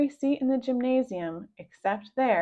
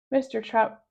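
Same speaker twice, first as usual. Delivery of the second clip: ordinary delivery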